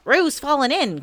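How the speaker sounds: loud, high alarmed call; urgent shout in character; crying out. A narrator's reading. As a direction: raise the alarm. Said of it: "cried Rabbit".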